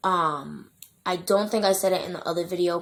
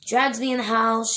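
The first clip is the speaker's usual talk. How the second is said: ordinary talk, nothing marked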